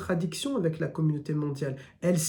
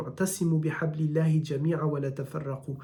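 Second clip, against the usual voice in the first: Quranic recitation. Retold or imitated. Teacher quotes Quranic verse in Arabic.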